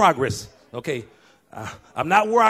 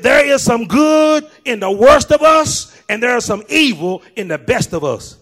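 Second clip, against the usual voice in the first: shouted repeat; 6 semitones higher, 7 dB louder than usual. Shown as a demonstration. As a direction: drive the line home at full force. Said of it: "I need to repeat that".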